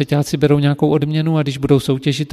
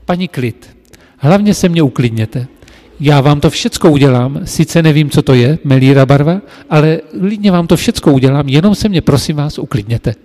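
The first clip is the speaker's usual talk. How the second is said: louder, pleading over her chatter; 8 dB louder than usual. Retold or imitated. Apprentice pleading loudly with the screaming customer. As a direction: talk over her loudly, begging her to calm down. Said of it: "A tak já jsem jí říkal".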